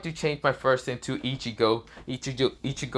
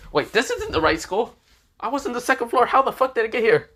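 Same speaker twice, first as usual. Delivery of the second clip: high, loud, rising panic; panicked disbelief; 11 semitones higher, 5 dB louder than usual. Acted. Lost new student shouting at himself, high panicked disbelief. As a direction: yelp it like he just woke up somewhere strange.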